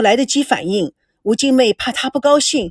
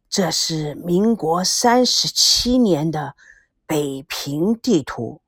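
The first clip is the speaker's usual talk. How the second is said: put-on very slow, low, hushed old man's voice; an old man lingering over a memory, much lower; old grandfather; 6 semitones lower, slower than usual. A narrator's reading. Grandfather shows the old Beijing map, slow and low.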